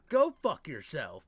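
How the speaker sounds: ordinary delivery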